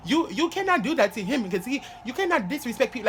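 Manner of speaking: put-on lightly done African accent, pitched high; African teacher accent. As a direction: scold like the Haitian teacher in her African accent. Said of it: "she had, like, an African accent".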